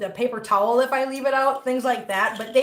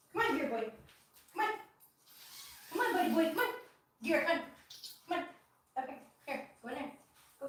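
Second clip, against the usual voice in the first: soft, higher coaxing voice for the cat; pet talk; 4 semitones higher, 6 dB quieter, faster than usual. Real, not acted. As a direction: softly coax the cat along.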